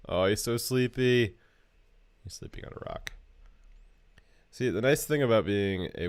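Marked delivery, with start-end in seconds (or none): 2.2-3.1 hushed whisper so as not to wake him. Real, not acted. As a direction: whisper it over the sleeping wolf. Softly whispering over sleeping wolf.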